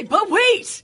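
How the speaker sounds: high, loud TV pitchman; infomercial voice. Retold or imitated. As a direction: shout it like a late-night ad host. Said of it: "reads like an infomercial".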